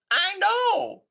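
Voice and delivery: put-on high loud kid's cry; child crying out. A narrator's reading. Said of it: "cried Froggy".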